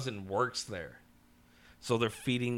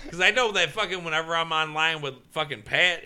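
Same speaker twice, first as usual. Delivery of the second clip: ordinary delivery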